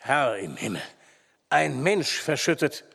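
understated appalled call; cry of horror. A narrator's reading. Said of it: "stieß einen Ruf des Entsetzens aus".

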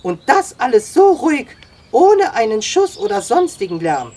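high, loud, excited marvelling; amazed admiration. A narrator's reading. Sam praising the boy's silent capture, amazed. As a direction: exclaim with escalating wonder.

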